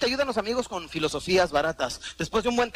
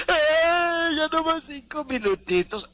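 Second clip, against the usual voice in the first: high, slow groggy plea for five more minutes; sleepy snoozer; 10 semitones higher, 4 dB louder, much slower than usual. Retold or imitated. Someone in bed begging for a few more minutes, groggy whine.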